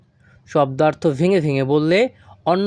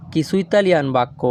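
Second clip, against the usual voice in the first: usual speaking voice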